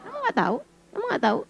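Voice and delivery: very soft, almost breathed query; soft teasing hush; hushed question. Acted. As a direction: drop it to a hush.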